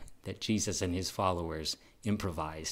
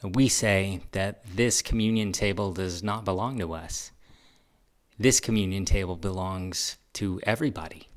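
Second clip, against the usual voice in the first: louder, ringing declaration of welcome; formal proclamation; 5 dB louder than usual. Real, not acted. Minister declaring communion open to all, firm and ringing. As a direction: proclaim the open table to everyone.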